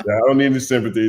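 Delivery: ordinary talk, nothing marked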